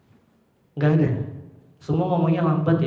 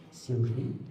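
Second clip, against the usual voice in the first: low and much softer, like sharing a secret; a hushed, conspiratorial question; secretive hush; 3 semitones lower, 11 dB quieter than usual. Shown as a demonstration. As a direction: lean in and murmur it confidentially. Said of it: "sangat rahasia".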